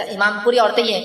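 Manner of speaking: ordinary delivery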